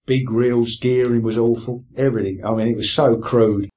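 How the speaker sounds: ordinary talk, nothing marked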